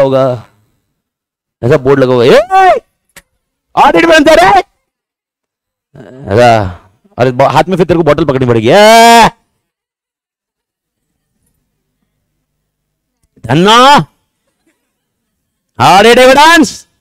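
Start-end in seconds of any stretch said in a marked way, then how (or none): 2.2-4.6 high-pitched yell up at a signboard; mock calling out. Teacher pretends to demand audit evidence from a company signboard, high mock shout. 8.7-16.9 long, rising mock holler; hammed-up filmi shout. Teacher pretends to demand audit evidence with bottle in hand, drawn-out filmi holler.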